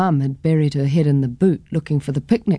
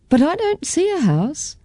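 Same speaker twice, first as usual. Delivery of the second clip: put-on child Caitlin; 11 semitones higher than usual. A narrator's reading. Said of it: "I said".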